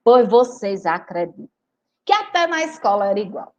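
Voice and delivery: ordinary delivery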